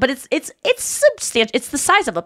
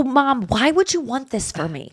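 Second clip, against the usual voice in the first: mild, softer plea to mom; retold pleading; 3 semitones higher, 4 dB quieter than usual. Daughter asking mom about kids, mildly pleading. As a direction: plead lightly, in retelling. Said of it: "I go".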